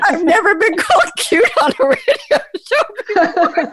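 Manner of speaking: a real laugh, words bubbling out. Real, not acted. Host laughing at being called cute on air. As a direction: laugh off the compliment.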